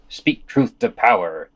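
ordinary talk

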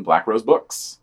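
ordinary delivery

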